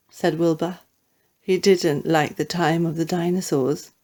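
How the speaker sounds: ordinary delivery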